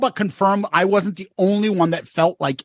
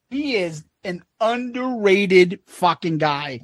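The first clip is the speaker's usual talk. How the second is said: ordinary talk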